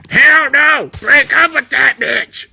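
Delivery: high, loud shout; defiant yell. Pretend. Dying dad yelling at the doctor, high and loud. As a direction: bellow it with your last breath.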